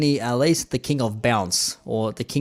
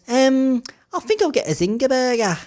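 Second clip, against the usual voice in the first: much higher mock Scouse girl voice; Scouse accent; 13 semitones higher, 5 dB louder than usual. Quoting a Liverpool girl ordering KFC, high Scouse accent. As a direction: pitch way up into a broad Scouse lilt. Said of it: "could not get more Scouse than that".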